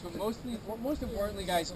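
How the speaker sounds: usual speaking voice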